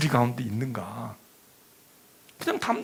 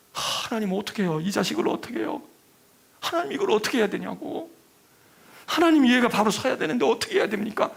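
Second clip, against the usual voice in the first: anguished plea; 6 semitones higher than usual. Retold or imitated. Mother pleading with God over her son, anguished.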